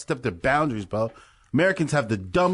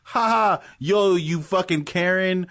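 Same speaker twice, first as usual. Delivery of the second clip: higher-pitched mock taunting voice; mocking jeer; 4 semitones higher, slower than usual. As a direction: jeer like a heckler. Acting out jeering stupid people.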